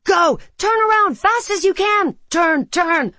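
overlapping cries. Acted. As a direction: yell it in a panic. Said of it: "everyone was shouting at once".

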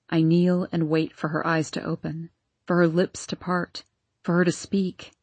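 husband pleading, close to tears; Arden's voice. Acted. Arden pleads for his wife.

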